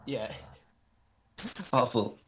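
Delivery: normal talking voice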